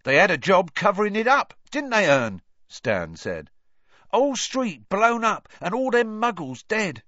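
high, loud cockney lad, mocking; Stan's voice. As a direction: tease him like a cheeky bus conductor. Stan asking Ern.

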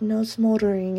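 usual speaking voice

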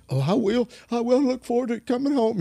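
high, eager and overjoyed; pumped up. Retold or imitated. The grieving husband answering the preacher, pumped and high. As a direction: gush it like a man given hope back. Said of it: "he was just so pumped about it".

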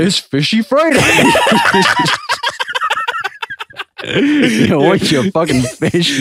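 rough, laughing dinner lady; slow, laughing mock dinner lady. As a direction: bark it out like a canteen lady. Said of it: "very quick role play".

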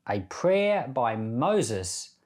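ordinary talk

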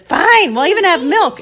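put-on high, loud child's concession; kids giving in. Kids accept milk from parents, loudly in high voice. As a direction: exclaim it in a put-on kid voice.

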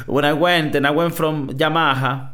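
hammed-up Italian accent. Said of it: "he's like".

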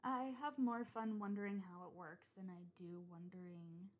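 ordinary talk, nothing marked

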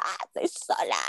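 imitating a distant child's cry; retold girl's scream. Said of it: "como un grito de una niña".

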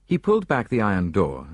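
usual speaking voice

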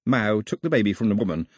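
plain reading voice

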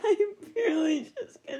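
giggling through the words; laughing. Real, not acted. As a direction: laugh it out while talking. Laughing after joke.

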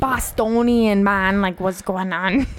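slow Boston attempt sliding into Jamaican; badly faked Boston accent that sounds Jamaican; drawn-out, accidental Jamaican lilt on a Boston accent; botched Boston accent. Shown as a demonstration. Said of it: "like, Jamaican".

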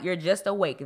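ordinary talk

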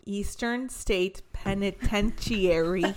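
Penitentiary slowly sounded out as "peni-ten-shee-air-ee"; slow stumble, Penitentiary with an extra "air" syllable; mispronounced. Real, not acted. Host trying to get the prison's name out, mispronounced. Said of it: "I kept saying it wrong".

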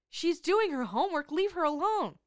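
high, loud protest; indignant outburst. Real, not acted. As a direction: defend her, loudly and exasperated.

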